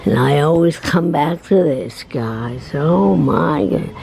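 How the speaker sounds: ordinary talk, nothing marked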